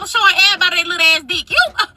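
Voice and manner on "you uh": high-pitched indignant tirade; mock outrage. Telling off the man at work, high-pitched mock outrage. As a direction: scold him in a high, scornful voice.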